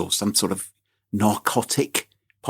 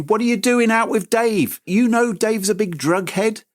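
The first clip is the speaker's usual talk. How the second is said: higher, a mock scolding parent; imitating furious parents; 7 semitones higher than usual. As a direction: scold like an annoyed mum, half in jest. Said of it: "They're gonna be furious with you".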